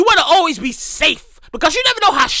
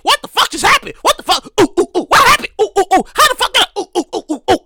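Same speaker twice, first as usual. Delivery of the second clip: confused; 4 semitones higher than usual. Retold or imitated. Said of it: "I was like".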